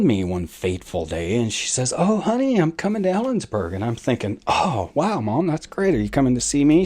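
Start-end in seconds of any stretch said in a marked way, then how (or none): 2.0-3.7 much higher sweet mom voice, quicker on the second line; voicing mom. 4.5-6.8 higher, eager reply; mock delighted. Own hopeful reply to mom.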